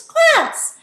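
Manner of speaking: very high, loud squeal of "class"; high-pitched. Shown as a demonstration. Teacher calling the class for giggles, high-pitched. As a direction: shoot "class" up into a squeaky top register. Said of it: "use a high-pitched tone of voice".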